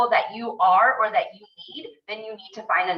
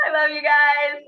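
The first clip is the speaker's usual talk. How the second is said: laughing; 7 semitones higher, slower than usual. Real, not acted. Laughing goodbye at photo time.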